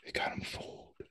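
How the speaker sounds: hushed conspiratorial whisper. Acted. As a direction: whisper a secret to your son. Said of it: "Eugene whispering".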